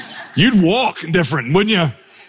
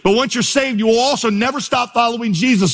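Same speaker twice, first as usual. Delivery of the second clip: raised, ringing preacher voice; preaching climax; 9 semitones higher than usual. Real, not acted. Preacher driving home the message, higher and ringing. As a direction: lift it up as the closing point.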